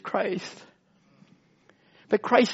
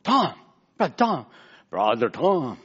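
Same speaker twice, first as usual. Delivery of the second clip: imitating Henry; 7 semitones lower than usual. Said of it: "He goes".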